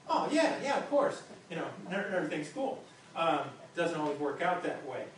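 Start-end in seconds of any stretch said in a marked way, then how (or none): 0.1-1.2 breezy reply. Retold or imitated. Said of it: "Josh would be like".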